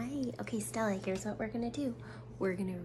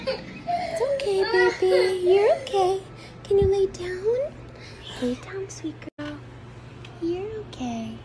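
much higher, louder, slower comforting; 12 semitones higher, 8 dB louder than usual. Real, not acted. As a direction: coax her back to lie down. Mother soothing crying toddler again.

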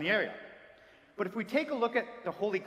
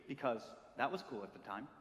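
usual speaking voice

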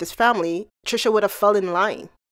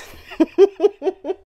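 real laughter, pitched up; high laugh; 8 semitones higher than usual. Real, not acted. Laughs after singing.

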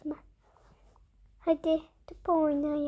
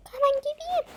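put-on a much higher, piping little girl doll voice; 11 semitones higher, 4 dB louder than usual. Little doll answering the call, in a much higher piping voice. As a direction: squeak it out like a tiny doll.

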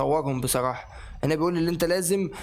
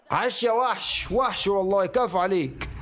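excited praise; 3 semitones higher, slower than usual. Real, not acted. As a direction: praise the player with a drawn-out cheer. Praising player after play.

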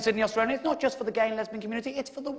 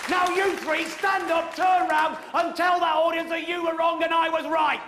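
loud, higher ordering voice; commanding shout; 4 semitones higher, 3 dB louder than usual. Real, not acted. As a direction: order the three to stand up.

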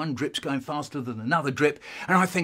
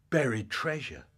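ordinary delivery

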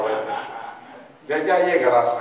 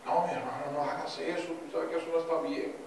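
a soft, quiet plea, held back; pleading softly; 3 semitones higher, 9 dB quieter than usual. Retold or imitated. Tamar pleading with her half-brother Amnón, soft and quiet. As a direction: beg him to stop, quietly.

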